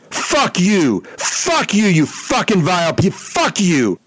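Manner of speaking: very high imitated scream of rage; high shrieked fury; mock screaming. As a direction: blow up like the raging pitcher. Rocker explodes.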